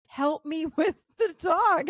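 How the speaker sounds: high, slow laughing mock husband; hammed-up husband asking for help; imitating husband. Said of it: "And he's like".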